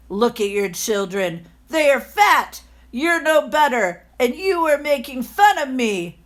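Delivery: put-on loud, raging old miser; furious landlord. The landlord threatening villagers who mocked him, loud and furious. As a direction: scold them like a spiteful tyrant. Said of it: "The miserly landlord was furious".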